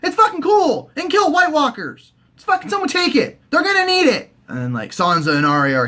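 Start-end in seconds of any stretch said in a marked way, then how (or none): 0.0-4.3 high, shrill-pitched pleading at the screen; much higher mock frustration; exasperated urging. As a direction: beg him to just take it. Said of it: "I'm like".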